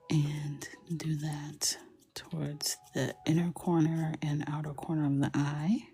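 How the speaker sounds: soft unvoiced whisper narration. Whispering to viewers while coloring with markers. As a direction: whisper the steps like an ASMR tutorial.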